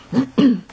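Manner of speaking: firm throat clear; clearing a dusty throat. Real, not acted. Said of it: "Oh, excuse me so much".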